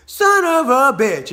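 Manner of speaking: slow, high, loud retold curse; hammed-up angry dad; dad going crazy. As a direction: draw out the swear in mock fury. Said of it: "he was going crazy for a minute".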